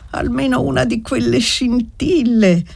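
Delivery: put-on high little-girl exclamation; child's cry. A narrator's reading. Little girl longing for a falling spark, high cry. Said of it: "gridò chinando la fronte enorme".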